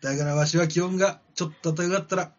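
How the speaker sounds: loud, rough yakuza-style growl; gruff gangster boss voice. Acted. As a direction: bark it like a tough old mob boss. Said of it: "ティタノボアさんの言葉".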